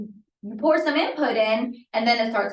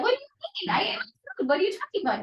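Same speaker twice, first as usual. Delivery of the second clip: put-on much higher playful child voice; high mock child answering the parent; imitating a clueless kid; 12 semitones higher, faster than usual. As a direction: play the oblivious kid with food on his face. Messy kid voiced at mealtime.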